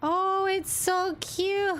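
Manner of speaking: high, drawn-out coo; cooing delight. Real, not acted. Streamer gushing over a cute model, cooing. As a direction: stretch it out high as if melting over something adorable.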